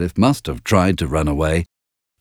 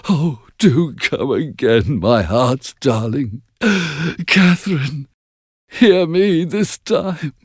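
slow, high, tearful begging; sobbing plea; 6 semitones higher, slower than usual. A narrator's reading. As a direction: beg through tears for her to return. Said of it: "he sobbed".

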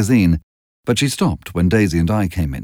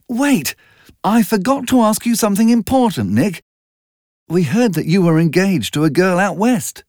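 much higher urgent call; 10 semitones higher than usual. A narrator's reading. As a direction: call after him as he drives off. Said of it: "Daisy called out".

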